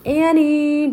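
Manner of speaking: loud, high shout into the dark. Acted. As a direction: call his sister in the dusk. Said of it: "Jack shouted".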